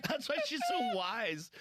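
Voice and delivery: high, loud, through real laughter; laughing hard. Real, not acted. Host teasing comic about his wise daughter, laughing hard. Cracking up at his own joke.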